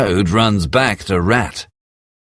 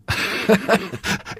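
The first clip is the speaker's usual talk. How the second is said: driver's laugh; 11 semitones higher, 6 dB quieter than usual. Acted. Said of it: "The driver laughs".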